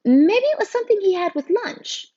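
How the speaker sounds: very high, puzzled teacher voice; flustered teacher. A narrator's reading. Teacher asking students about an allergy, flustered.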